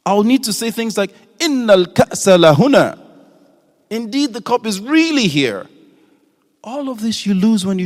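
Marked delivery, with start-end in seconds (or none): 1.4-3.0 Innal and lahuna both stressed, louder; strongly emphatic Innal ka'sa lahuna; double emphasis. Shown as a demonstration. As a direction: insist hard to a doubter. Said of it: "one emphatic particle will not be enough".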